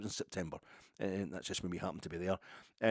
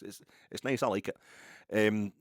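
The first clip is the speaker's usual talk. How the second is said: ordinary talk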